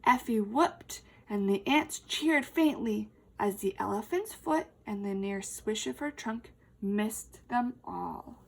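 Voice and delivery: ordinary delivery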